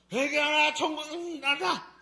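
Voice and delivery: "회개하라" cried high and loud, next words garbled; mock preacher's yell slurred into nonsense; deliberately unintelligible shout. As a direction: rattle off the warning so nobody catches it. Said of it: "야, 못 알아듣게".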